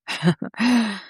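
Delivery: short scornful acted laugh; mocking laugh. A narrator's reading. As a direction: laugh derisively at the wife. Husband mocks wife's pyjamas. (ضحكة سخرية قصيرة)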